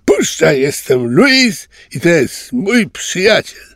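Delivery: scare the bird off your friend; growling little ocelot. A narrator's reading. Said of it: "warczał ocelocik". (warczący mały ocelot, wysoko i groźnie)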